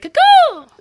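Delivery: high loud cuckoo bird call. Pretend. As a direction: sing out like a cuckoo clock. Calling someone crazy.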